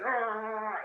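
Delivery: choked wordless groan; a garbled cry instead of sorry; strangled groan. Retold or imitated. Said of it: "all that came out was".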